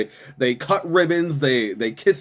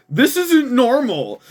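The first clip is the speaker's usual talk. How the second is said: mocking quote; 10 semitones higher, 5 dB louder than usual. Real, not acted. Mock-reading the official's quote.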